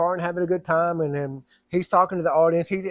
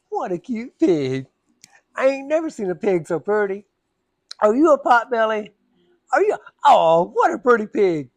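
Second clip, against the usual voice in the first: higher, louder fake-sweet baby talk; mock sweet teasing; 4 semitones higher, 4 dB louder than usual. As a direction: gush over the dog as a pig to needle her. [name] teasing the lady.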